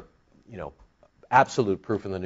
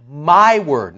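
MY drawn out, high and loud; emphatic, stretched "my"; stress on MY; 3 semitones higher, 6 dB louder than usual. Shown as a demonstration. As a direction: lean hard on "my" before "word". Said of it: "let's put the emphasis on the my".

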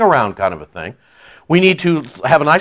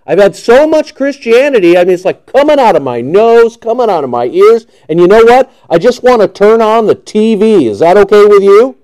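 high, emphatic fed-up rant; mock exasperation; 5 semitones higher, 5 dB louder than usual. Believer who just wants some TV, mock exasperation. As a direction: play someone at the end of their patience. Acts being fed up with Christianity.

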